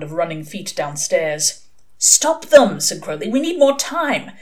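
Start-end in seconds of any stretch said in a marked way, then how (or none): 2.0-2.8 loud, high demand; pressing, raised-voice plea; urgent command. A narrator's reading. Crowley urgently orders the angel. 3.3-4.3 high-pitched, pressing demand; agitated insistence; urgent plea. A narrator's reading. Crowley urgently orders the angel.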